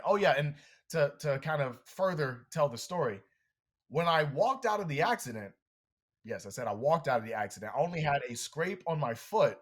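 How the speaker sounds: ordinary talk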